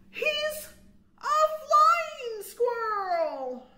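high, loud and very slow; grand flying reveal; triumphant reveal. A narrator's reading. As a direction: stretch it out in wonder. Big reveal in story.

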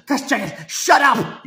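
loud, high "shut up!" yelled at the TV; fed-up shout at the commentator; exasperated shout. Retold or imitated. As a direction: yell at him to shut up. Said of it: "It's like".

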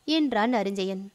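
ordinary delivery

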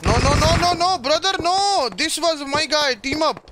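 high, loud panicked no; alarmed shout. Real, not acted. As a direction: shout to stop a teammate. Teammate shoots his ally.

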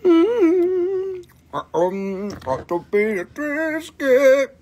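humming through a full mouth; closed-mouth mm-hmm while chewing. Real, not acted. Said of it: "My mouth is full".

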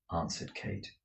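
ordinary delivery

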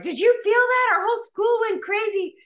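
put-on loud, very high excited kid voice; excited son. Son reporting the earthquake to mom, high excited outburst. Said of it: "My son was like".

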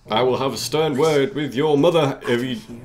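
a hint of a stern British gent, slow; lightly put-on British scold; mock British. Gamer scolding the cult figure in mock British. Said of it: "That's so British".

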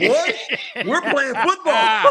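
high, loud, drawn-out mock enthusiasm; mock gung-ho. Imitating coach who took preseason seriously, gung-ho. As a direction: stretch it out big, half laughing.

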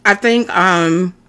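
chewing between words; talking while chewing. Real, not acted. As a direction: mouth partly full of food. Seen 2: Bites samosa at -2, lips pressed chewing through early words.

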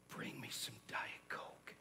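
slow, barely audible whisper; inaudible request. Acted. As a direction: whisper it so faintly nobody could hear. Acts too quiet to hear.

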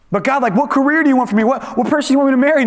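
high, rushed barrage of questions; imitating someone pestering God impatiently; frantic pleading.

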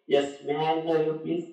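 low, subdued phone question. Acted. Asking the caller again after being hushed, low and contained. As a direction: bring it down and ask politely.

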